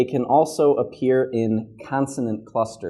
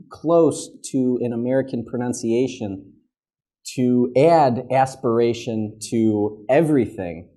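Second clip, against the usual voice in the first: ordinary talk, nothing marked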